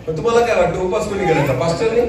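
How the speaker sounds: normal talking voice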